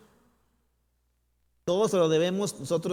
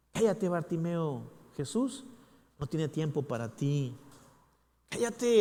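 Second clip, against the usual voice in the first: taunting inner voice; 7 semitones higher, 4 dB louder than usual. Retold or imitated. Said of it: "voz interna le decía".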